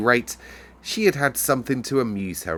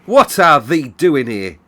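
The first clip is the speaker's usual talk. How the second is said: loud, higher, slow Yorkshire scold; cross housekeeper; 6 semitones higher, 12 dB louder, slower than usual. A narrator's reading. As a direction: snap at the girl, furious. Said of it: "very cross look on her face".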